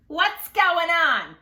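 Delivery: slow, loud and high puzzlement; confused monkey. Acted. Grumpy monkey asking what is wrong, slow and loud. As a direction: draw it out, baffled. Jim voiced in story.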